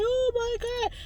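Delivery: very high-pitched imitated wail; mock bawling. Dreamer who has lost everyone they love, mock bawling. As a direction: act out bawling without real tears. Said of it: "was bawling in my dream, bawling".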